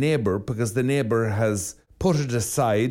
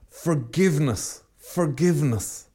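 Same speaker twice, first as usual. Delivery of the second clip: higher, slow, elated whisper of joy to himself; a hint of rapture, savouring the word; lightly voiced overjoyed man; 5 semitones higher than usual. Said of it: "absolutely elated".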